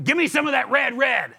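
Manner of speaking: high, loud Southern caveman demand. Acted. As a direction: holler for food like a dim brute. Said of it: "such a deep voice or a southern accent".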